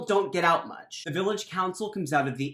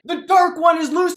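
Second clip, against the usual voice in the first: much higher, louder panicked cry; 11 semitones higher, 9 dB louder than usual. Acted. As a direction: shriek it like doom has come. Villager panics at dragon news.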